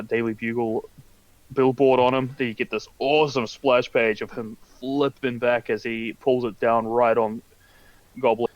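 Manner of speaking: ordinary delivery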